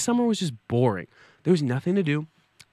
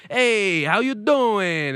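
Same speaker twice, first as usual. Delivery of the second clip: Italian greeting; 6 semitones higher, 3 dB louder, much slower than usual. Retold or imitated. Imitating Italian festival-goers.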